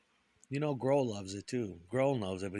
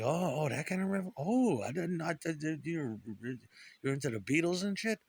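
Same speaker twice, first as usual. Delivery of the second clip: higher-pitched stammering realization; flustered surprise; 6 semitones higher than usual. Retold or imitated. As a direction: stumble over the words, re-enacting pleased surprise. Retold flustered reaction to her answer.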